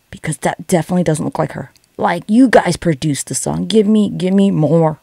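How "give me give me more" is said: ordinary delivery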